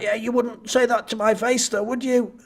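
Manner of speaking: put-on childish taunt. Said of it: "like a twelve-year-old kid".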